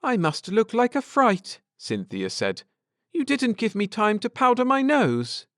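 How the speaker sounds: fussing about her looks; playful fluster. A narrator's reading. Cynthia fusses.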